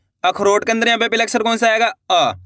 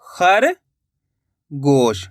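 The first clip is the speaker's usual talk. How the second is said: drawn-out word; 6 semitones lower, much slower than usual. Shown as a demonstration. Teacher to pupils, stretching खरगोश slowly. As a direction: stretch खरगोश very slowly, every sound held long. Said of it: "teacher completing the spelled word".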